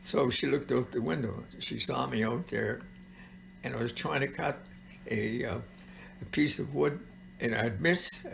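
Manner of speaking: ordinary delivery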